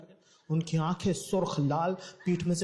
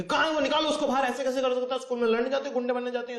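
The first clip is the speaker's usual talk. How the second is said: higher, louder scolding; mother yelling at the bully's house, higher and louder; angry mother; 8 semitones higher, 4 dB louder, faster than usual. Retold or imitated. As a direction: rage at the door.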